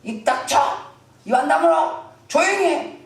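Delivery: acted angry crowd telling someone off; high, punchy hushing demands; scolding shouts. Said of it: "사람이 막 소리를 지른 거예요".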